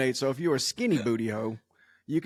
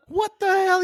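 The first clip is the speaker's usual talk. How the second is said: shocked shriek; 7 dB louder, slower than usual. Retold or imitated. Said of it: "And she goes".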